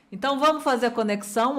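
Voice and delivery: normal talking voice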